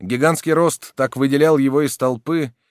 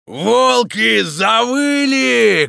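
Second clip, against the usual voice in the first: loud, high, drawn-out bellow; booming shout; 12 semitones higher, 8 dB louder, much slower than usual. A narrator's reading. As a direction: roar it out slowly to a crowd. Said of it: "кричал он".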